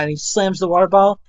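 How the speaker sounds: ordinary talk, nothing marked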